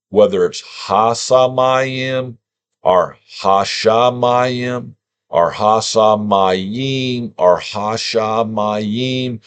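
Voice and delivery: slow Sa versus Sha; Samayim or Shamayim. Shown as a demonstration. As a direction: slowly alternate Samayim with S and Shamayim with Sh. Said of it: "Sa versus Sha".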